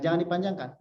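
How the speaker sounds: ordinary delivery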